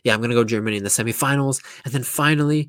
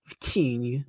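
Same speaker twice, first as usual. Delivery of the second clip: put-on thick Portuguese accent; slower than usual. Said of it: "like, the most thickest accent".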